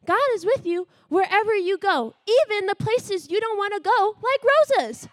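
normal talking voice

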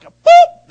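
high, loud comic pop; a sudden high "boop!" like a cork popping. Shown as a demonstration. Sudden pop sound mimicked mid-story.

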